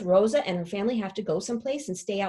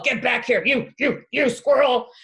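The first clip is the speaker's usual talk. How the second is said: dog yelling; 2 semitones higher, 6 dB louder than usual. Acted. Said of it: "He's just all".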